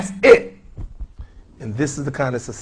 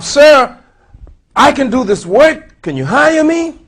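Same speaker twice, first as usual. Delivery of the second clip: pleading job seeker; 11 semitones higher, 10 dB louder, slower than usual. Acted. Acted ex-con begging for job.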